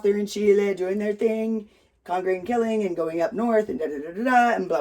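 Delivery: ordinary delivery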